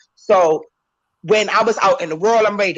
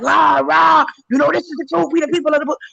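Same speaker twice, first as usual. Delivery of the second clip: mock fired-up proclaiming, pitched high; mock zealous preacher; 9 semitones higher than usual. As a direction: play the overzealous rah-rah brother. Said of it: "So it's like".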